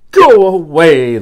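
loud, high, stretched-out dismissal; shooing away. Acted. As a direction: send the lion off impatiently. Busy man shoos the lion.

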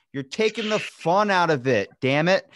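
high, exasperated complaint; mock protest. Retold objection to the pitcher's seriousness, mock protest. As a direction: protest loudly at a killjoy.

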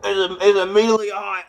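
real loud blurt as the heat hits; pained exclaim. Real, not acted. Eating the Carolina Reaper, loud pained blurt. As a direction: shout it out as your mouth burns.

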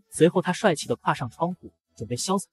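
ordinary delivery

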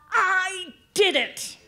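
high, drawn-out triumphant shout; loud shout. Shown as a demonstration. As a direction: shout it out slowly to lead the crowd. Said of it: "in your loudest voice, I want you to shout really loud".

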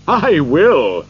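loud, delighted acceptance; happy cry. A narrator's reading. Old gentleman agrees to visit Scrooge, crying happily. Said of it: "cried the old gentleman happily".

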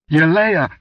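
high, loud scream of a name; terrified scream. A narrator's reading. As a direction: cry out in shock and dread. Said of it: "screamed Gorulga".

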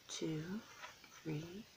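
ordinary delivery, nothing marked

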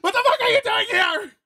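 relieved yell. Acted. Relieved outburst at rescuer.

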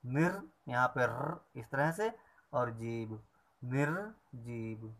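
निर then जीव, said apart, then निर्जीव slowly whole; careful slow syllables; syllable split. Shown as a demonstration. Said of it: "दोनों का उच्चारण किया जाएगा".

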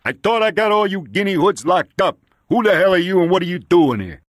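high, forceful, hostile demand; angry police captain. Acted. Police captain confronting a suspect, angry and bullying. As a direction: tear into him, loud by the end.

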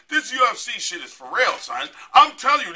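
ordinary talk, nothing marked

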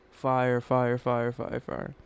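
usual speaking voice

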